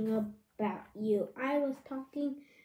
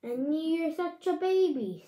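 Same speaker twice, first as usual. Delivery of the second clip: usual speaking voice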